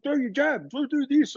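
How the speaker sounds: high, urging coach voice; imitating the coach. Coach Thierry urging him forward while sparring, high mock urging. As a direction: push him on like a coach in the corner. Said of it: "he's like".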